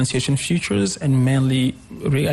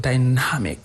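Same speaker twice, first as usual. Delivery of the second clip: dynamic said as dy-NAM-ic; stress on second syllable; 2 semitones lower than usual. Shown as a demonstration. Teacher correcting where the stress goes, second-syllable stress. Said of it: "on the second syllable".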